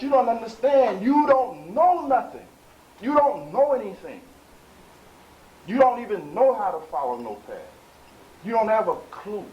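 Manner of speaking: high, loud scolding from the pulpit, stretched on key words; impassioned preaching rebuke. Real, not acted. Preacher rebukes followers who invent their own path, impassioned preaching.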